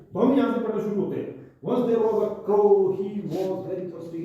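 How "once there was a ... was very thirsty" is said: slow, careful word-by-word reading; halting beginner's read-aloud. Shown as a demonstration. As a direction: read it out slowly like a learner. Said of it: "ये तो हमारे पढ़ने आ जाते हैं".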